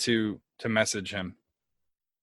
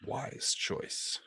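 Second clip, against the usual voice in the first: hushed, near-voiceless approval; ominous dark lord; 3 semitones higher, 11 dB quieter than usual. Acted. Posing as a tyrant god, servant has accepted, hushed. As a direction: let it out softly, like a pleased god.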